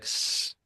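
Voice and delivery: imitated bird hiss, drawn out softly; soft, voiceless mock hissing; low, ominous hiss. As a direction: hiss softly like a threatening goose. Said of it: "they just start hissing, like, this kind of low, sort of ominous".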